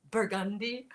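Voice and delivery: "Burgundy" in a soft whisper; whispery narrator impression; imitating audiobook narrator. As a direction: breathe out "Burgundy" like a hushed narrator. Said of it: "your emphasis on certain words".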